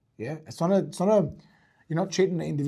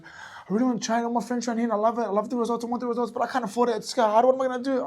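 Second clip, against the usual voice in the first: higher, pleading worried client voice; anxious client; 9 semitones higher, 6 dB louder than usual. Retold or imitated. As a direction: play the stressed client who can't afford it. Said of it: "'cause she's like".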